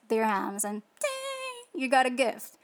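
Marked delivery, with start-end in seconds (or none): 1.0-1.8 mock ding. Imitating a shop bell.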